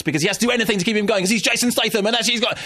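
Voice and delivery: building to a high, hyped-up rush; mock-frantic excitement. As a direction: get carried away, pitch climbing as you go. Mock-excited rant building about film.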